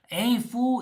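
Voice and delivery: put-on Southern California Mexican American voice; Mexican American accent. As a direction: call out in Chicano-accented English. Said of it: "who talk like this".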